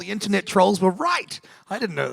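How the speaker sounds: high and loud, fake outrage; mock shock. Castmate jokes about internet trolls, in mock shock. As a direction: gasp it out as a sarcastic joke.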